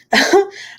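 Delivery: short high giggle; quick high-pitched laugh. Real, not acted. Laughing at own retort.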